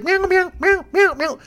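high-pitched, repeated frantic meows; imitated cat meowing. Cat bounced off him onto the ground, imitated frantic meowing. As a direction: mimic an agitated cat, very high. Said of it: "He's like".

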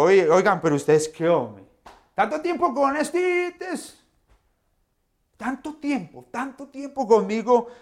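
hammed-up exasperation; exasperated scolding. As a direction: throw up your hands at friends who still don't get it. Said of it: "Yo no me imagino yo respondiéndoles de esta manera".

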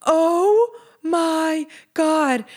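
long, drawn-out high gush; overjoyed. Retold or imitated. Reacting to friend's big news.

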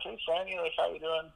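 a hint of an upbeat coach greeting his player; imitating the coach.

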